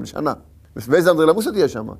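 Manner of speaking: ordinary talk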